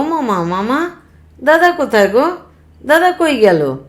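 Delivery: put-on high, loud small-boy questioning; eager little child. Little brother asking uncle where big brother went, high and eager. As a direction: pipe up like an excited toddler.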